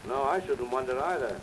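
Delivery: ordinary talk, nothing marked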